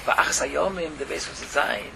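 normal talking voice

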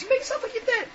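very high, squeaky little bird voice; high-pitched advice. Acted. Sister bird shares her escape trick, high-pitched advice.

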